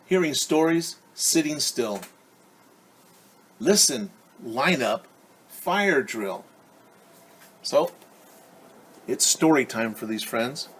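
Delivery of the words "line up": usual speaking voice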